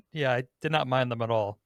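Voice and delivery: ordinary talk, nothing marked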